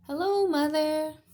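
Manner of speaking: hammed-up young dinosaur, sorry for himself; little dinosaur voice. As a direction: play the sad little T-Rex. Tiny greets mother.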